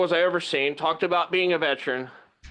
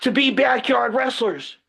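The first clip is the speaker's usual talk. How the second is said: high-pitched, indignant retold yell without extra volume; 8 semitones higher than usual. Trainee telling off the visiting trainer, retold mild yell. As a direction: re-enact yelling back at him, pitch raised. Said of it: "I started yelling at him".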